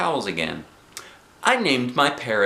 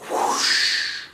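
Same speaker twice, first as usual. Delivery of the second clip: long breathy whoosh; whoosh of air. A narrator's reading. Daddy opens the air plug, whoosh. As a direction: imitate air rushing from a plug.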